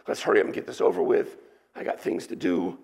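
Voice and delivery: fast, rushed brush-off; impatient hurry. Retold or imitated. An imagined hasty Jesus brushing off the blind man, fast. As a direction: rattle it off like you have things to do. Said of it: "In no way is this rapid".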